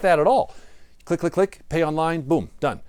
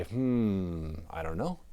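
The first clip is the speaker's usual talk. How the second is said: soft, low musing with chin in hand; hushed, thoughtful puzzling; pondering hmm; 7 semitones lower, 9 dB quieter, much slower than usual. Shown as a demonstration. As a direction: mull it over quietly. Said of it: "go like this, say".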